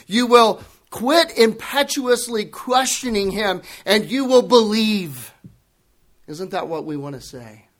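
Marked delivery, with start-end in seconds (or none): 0.0-5.3 higher, loud fervent proclamation; yelled out. Pretend. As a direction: shout the truth at the doubters. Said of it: "we want to yell out to him".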